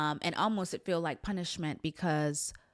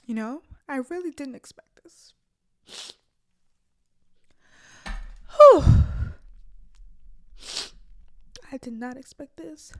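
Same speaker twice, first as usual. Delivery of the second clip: holding back tears; 7 semitones higher, 9 dB louder than usual. Real, not acted. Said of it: "I did not want to cry on camera".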